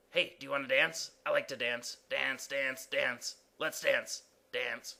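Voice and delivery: quick, chirpy, then dreamy; dance-crazy character. Acted. Exclamation point begs someone to dance, eagerly. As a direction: bounce through the words, eager to dance.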